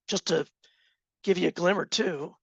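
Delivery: ordinary talk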